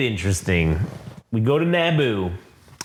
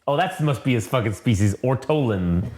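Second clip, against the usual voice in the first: ordinary delivery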